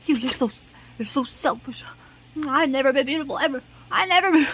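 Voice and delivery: loud, fast, rising complaint; loud accusing wail. Retold or imitated. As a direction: hurl it back at her in a wail. Acted quarrel with Sophie.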